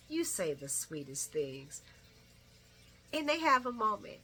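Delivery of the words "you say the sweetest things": mild mock sweet. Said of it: "he replies".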